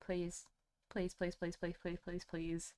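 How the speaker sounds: soft, low run of pleases; pleading. Real, not acted. Streamer begging the stream not to die, pleading. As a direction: beg under your breath, word after word.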